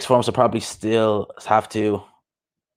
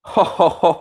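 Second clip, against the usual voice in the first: laugh; 8 semitones higher than usual. Real, not acted. Reacting to fight news.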